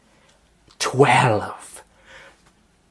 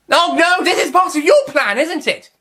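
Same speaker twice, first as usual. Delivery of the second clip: shrill dismay; 5 dB louder than usual. Acted. Master realising trick.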